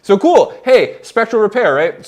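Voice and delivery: real excitement at the sound; excited. Real, not acted. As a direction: burst out with delight at the result. Delighted by the tool result.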